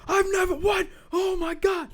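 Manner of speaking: high-pitched hammed-up outcry; mock shocked shout. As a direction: exclaim like an over-dramatic TV reaction. Acts out host's shocked reaction.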